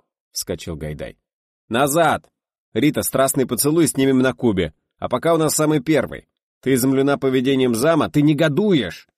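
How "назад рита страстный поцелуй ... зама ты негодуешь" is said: high, forceful correcting shout; loud scolding direction. A narrator's reading. Director corrects his actress after the take, shouting. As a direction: drive each instruction home at full voice.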